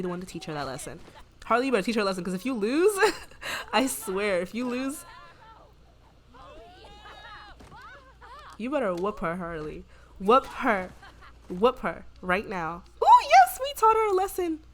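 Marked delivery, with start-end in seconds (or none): 1.4-12.8 cheering on. Real, not acted. Cheering Harley on. 13.0-14.6 very high and loud victory cry; high triumphant yes; triumphant whoop. Real, not acted. Celebrates the fight win.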